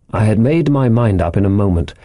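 ordinary delivery

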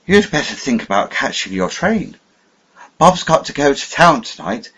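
put-on high, loud, falsely genial old man; falsely friendly. A narrator's reading. Old man rushing nephew off to his train, falsely genial. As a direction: sound hearty but hollow. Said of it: "The voice rang now as false as hell".

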